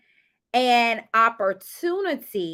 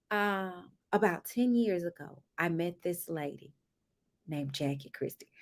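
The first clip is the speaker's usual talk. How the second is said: mock spooky story; 6 semitones lower, 9 dB quieter than usual. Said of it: "and British said".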